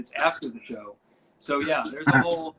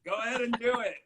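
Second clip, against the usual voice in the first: higher, loud cackling Vince laugh; Vince's laugh; 9 semitones higher, 6 dB louder, faster than usual. Retold or imitated. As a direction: bark out Vince's laugh then the order. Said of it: "you know that laugh".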